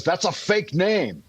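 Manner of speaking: mock scornful quote. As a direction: drag it out like a smug critic. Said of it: "and everybody says".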